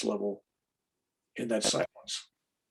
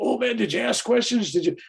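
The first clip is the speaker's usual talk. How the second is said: pitched-up eager questioning; eager mock question; 9 semitones higher than usual. People asking about his experience, high eager mock tone. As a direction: imitate a wide-eyed fan begging for details. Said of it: "'cause people go".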